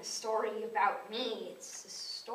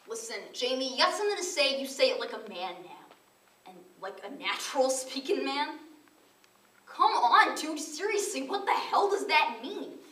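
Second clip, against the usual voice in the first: loud, heated telling-off; exasperated demand, building louder and higher; angry; 5 semitones higher, 11 dB louder than usual. A narrator's reading. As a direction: lay into him, voice rising in pitch and volume. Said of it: "which made Quisp mad".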